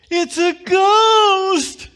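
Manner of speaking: high, loud panicked shout; frightened disciples screaming out across the water; terrified cry. Acted. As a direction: scream it like they've seen a ghost. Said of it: "they cried out".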